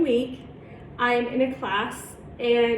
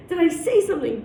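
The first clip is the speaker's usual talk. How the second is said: raised-pitch questioning, mildly distressed; retold upset; 6 semitones higher, 3 dB louder than usual. Youth worker asking director for a reason, mildly upset. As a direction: press for an answer, a touch upset. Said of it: "I was just really upset".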